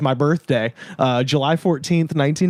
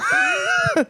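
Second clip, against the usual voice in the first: shrieking laugh. Real, not acted. Burst of laughter at discovery.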